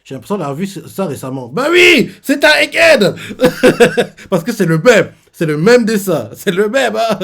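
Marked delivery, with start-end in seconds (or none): 1.5-5.1 much higher and louder, bursting out; excited shout. Pretend. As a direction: blurt it like a sudden discovery. Mock eureka about the symbol.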